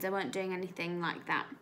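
normal talking voice

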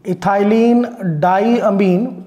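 very slow, stretched syllables; slow dictation. Shown as a demonstration. Teacher dictating a written name to students, slow dictation. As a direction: draw each part out for note-takers.

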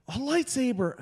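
put-on high-pitched child in wonder; awed little girl. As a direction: imitate a thrilled six-year-old. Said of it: "And then she's like".

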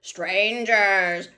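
loud, strange spider warning; clicking spider cry. Acted. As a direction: bark it out as a spider. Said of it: "clicked the spider".

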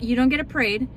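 ordinary delivery

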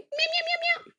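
very high, soft little kitten meow; tiny meow. Retold or imitated. Mother mocking a little cat, high meow. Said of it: "no little bitty meow".

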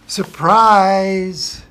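loud, drawn-out joyful surprise; storyteller's grand reveal; big surprise. Acted. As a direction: announce the hatching with delight. Chick hatches in the story.

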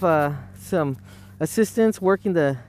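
ordinary delivery

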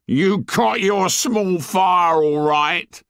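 high, slow sour sarcasm; drawn-out bitter mockery; sour sarcastic joke. A narrator's reading. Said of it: "the sour joke".

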